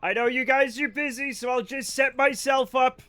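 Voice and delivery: ordinary delivery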